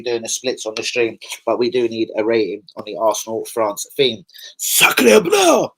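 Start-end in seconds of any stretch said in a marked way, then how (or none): 4.6-5.7 much higher, loud hammed-up French exclamation; mock French shout. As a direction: belt it out like a cartoon Frenchman. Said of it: "shouts out to France on that one".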